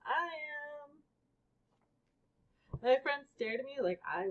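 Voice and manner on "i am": put-on higher, eager kid's reply; lightly voiced excited kid; eager answer. A narrator's reading. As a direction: blurt it out proudly. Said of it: "knew what to shout".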